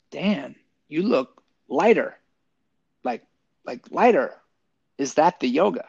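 spaced-out words, key word lifted; slowly wondering, with pauses between phrases; slow, searching question. Retold or imitated. Army buddy to Dan at the golf event, slow and wondering.

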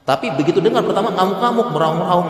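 high, loud and fast, hammed-up rage at bad news; mock wailing outburst. Preacher to the congregation, a hammed-up wailing outburst. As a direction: act out the panic of someone hit by disaster.

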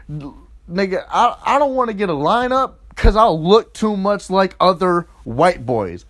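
normal talking voice

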